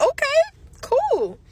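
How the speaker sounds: mock chipper okay. Caller agreeing to the quoted price, mock cheerful. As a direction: play it breezy and pleased. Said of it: "I'm like".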